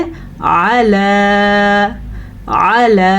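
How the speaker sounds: अला read slowly with a clear opening ain; slow correct अला. Shown as a demonstration. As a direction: say अला the right way, not like alaa. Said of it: "हमने इसको पढ़ना है".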